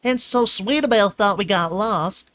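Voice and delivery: high, loud, eager little filly rattling on; bubbling over to tell her day; excited filly chatter. A narrator's reading. Filly gushing to Twilight about the park, excited.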